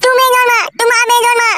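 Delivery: put-on high, pleading child's voice; whiny little boy. Small son begging father for big doll, whining. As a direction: beg like a spoilt kid.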